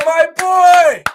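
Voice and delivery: loud, high, drawn-out gloat; triumphant taunting call; gloating shout. Retold or imitated. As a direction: yell it down the stairs to rub it in. Taunting the kid after win.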